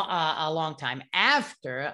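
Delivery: usual speaking voice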